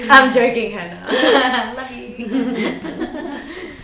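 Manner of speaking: laughing loudly through the apology; loud giggly take-back to Hannah. Real, not acted. Teasing a friend, laughing.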